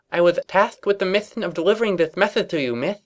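filly voice. A narrator's reading.

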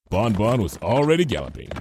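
usual speaking voice

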